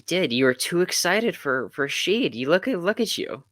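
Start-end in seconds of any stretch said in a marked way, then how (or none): none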